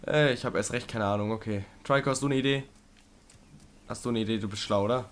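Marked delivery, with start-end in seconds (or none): none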